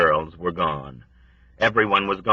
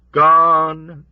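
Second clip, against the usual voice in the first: high, louder mournful word; mournful old woman; 8 semitones higher, 6 dB louder than usual. A narrator's reading. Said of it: "mourned the old woman".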